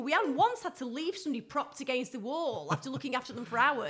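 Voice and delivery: ordinary talk, nothing marked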